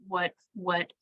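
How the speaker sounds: usual speaking voice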